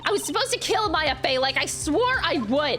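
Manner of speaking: shrieking villainess. Acted. Villain shrieking in fury at her old enemy. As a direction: scream her hatred at the ghost.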